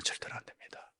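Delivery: hushed whispered warning. Real, not acted. Pastor warns the congregation in a hushed whisper. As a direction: drop to a breathy whisper for weight.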